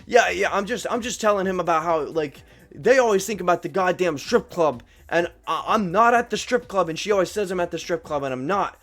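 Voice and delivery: high-pitched, indignant mock protest; imitated customer ranting. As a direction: plead your case like the worked-up drunk customer. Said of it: "this guy yelling from across the store".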